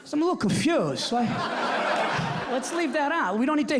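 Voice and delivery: put-on high, hearty old Italian dad; dad on the phone. Bronx father calling his son, hearty high greeting. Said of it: "He goes".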